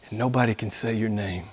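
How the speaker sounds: ordinary talk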